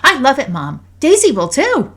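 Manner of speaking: put-on high, loud, thrilled child; excited little girl; delighted daughter. A narrator's reading. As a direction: gush to Mom in a kid's high voice. Voices delighted daughter hugging her mother.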